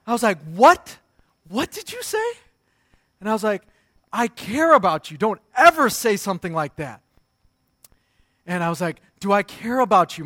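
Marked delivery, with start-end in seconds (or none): 0.5-2.2 high, loud, incredulous dad; indignant, pitched way up; retold angry outrage. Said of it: "I got so angry". 4.1-7.0 loud, indignant dad telling off his son; acted-out parental anger; retold angry scolding. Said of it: "I got so angry". 9.2-10.2 loud, stern parental question; acted-out cross dad; retold angry demand. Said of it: "I got so angry".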